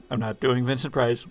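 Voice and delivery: usual speaking voice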